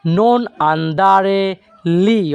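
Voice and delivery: very slow, every syllable drawn out; slow model phrase. Shown as a demonstration. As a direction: show the whole phrase at learner speed. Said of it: "আর যদি এই শব্দগুলো আমরা একসাথে বলি". (খুব ধীরে উচ্চারণ)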